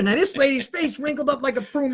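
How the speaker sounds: ordinary talk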